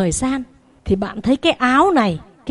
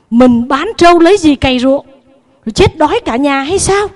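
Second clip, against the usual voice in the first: raised, louder protest, voice climbing; angry retort; 4 semitones higher, 6 dB louder than usual. Acted. Husband scolding his wife over selling the buffalo, angry raised voice. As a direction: push back hard, offended and incredulous.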